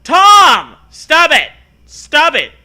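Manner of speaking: high, very loud scolding yell; hammed-up parental shout. A narrator's reading. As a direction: bellow each command at the boy. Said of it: "I yelled at him".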